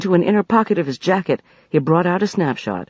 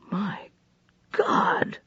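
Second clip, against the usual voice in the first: softer, breathed out in awe; 4 semitones higher, 8 dB quieter than usual. A narrator's reading. Farrell staring at the sleeping man, breathed. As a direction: barely voice it, stunned. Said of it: "breathed Farrell".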